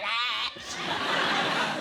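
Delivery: laughing shouted squawk like a seagull trapped in a lift; mock shrieking "Ah". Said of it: "full of people like".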